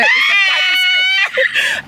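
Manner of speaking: high, slow dramatic exclamation; mock alarm. Daughter warning mom in the store, high dramatic call. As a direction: announce it like a spooky warning. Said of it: "and I said".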